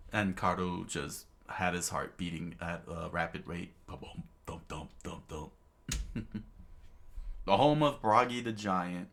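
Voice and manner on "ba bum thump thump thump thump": whispered heartbeat. Acted. Imitating a racing heartbeat.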